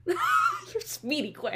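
drawn out, high and playful; amused echo. Real, not acted. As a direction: savor the compliment teasingly. Echoing friend's compliment, amused.

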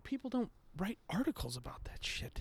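whispered aside; hushed grumble. Real, not acted. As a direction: breathe it under your voice. Aside complaint.

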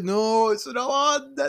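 exaggerated high-pitched disbelief; mock shocked protest. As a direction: voice climbing very high. Acted shocked reaction to retold insult.

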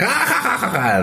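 big high party laugh; loud mock laughter. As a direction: ham up a roaring group laugh. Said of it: "the Mexicans are like".

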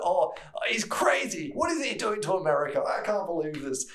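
hammed-up outrage about America; mock attendant rant. Attendant rants on politics.

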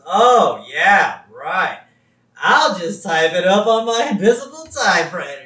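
loud, mock-agreeable character voice; Wickham. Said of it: "And Wickham says".

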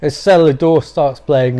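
usual speaking voice

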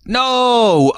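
high shouted no; dramatic no. Acted. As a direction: cry out the verdict. Dramatic reveal.

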